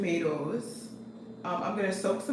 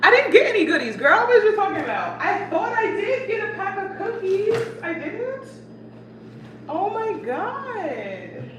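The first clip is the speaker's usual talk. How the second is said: laughing disbelief; 11 semitones higher, 4 dB louder than usual. Real, not acted. Realises she bought no cookies.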